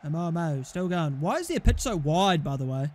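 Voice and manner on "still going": ordinary talk, nothing marked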